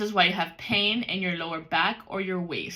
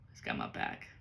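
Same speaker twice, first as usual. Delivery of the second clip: whisper; 11 dB quieter than usual. Real, not acted. Whispered aside.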